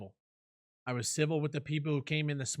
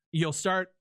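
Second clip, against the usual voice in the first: usual speaking voice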